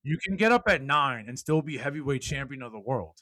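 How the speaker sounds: loud, high motivational speech; fired-up preaching. Acted. Speaker rallying podcast listeners, loud preaching. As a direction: preach it to the whole room.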